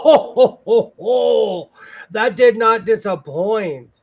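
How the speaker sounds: high, slow words through real laughter; laughing. Real, not acted. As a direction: laugh it out, delighted. Laughing at a silly hat.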